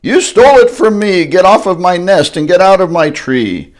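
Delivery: high, loud furious scolding; angry demand. Acted. Maisie driving Horton from the nest, loud furious scolding. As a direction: order him off the nest.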